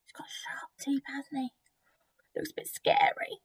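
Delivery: half-whispered scary confidence; hushed aside. Real, not acted. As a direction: whisper it conspiratorially. Hushed aside to young listeners.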